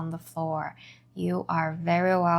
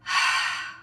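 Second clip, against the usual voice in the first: long voiceless sigh out; audible sigh; 3 dB quieter than usual. Shown as a demonstration. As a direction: breathe out in a relaxing sigh. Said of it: "sigh it out".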